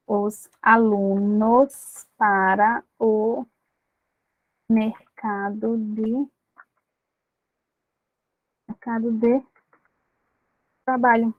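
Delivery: drawn-out, spaced dictation; slow dictation. Shown as a demonstration. Teacher dictating the phrase to students, slowly. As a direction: give it slowly so they can write it.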